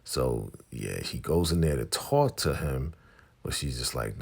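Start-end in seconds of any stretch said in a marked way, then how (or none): none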